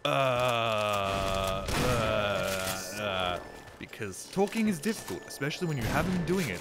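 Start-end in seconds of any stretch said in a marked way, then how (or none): 0.0-3.4 exaggerated, drawn-out filler sounds; hesitant old self. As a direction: stall endlessly, stretching each um. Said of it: "And also slower and calmer and way more".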